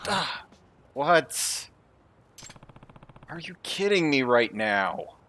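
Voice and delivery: ordinary talk, nothing marked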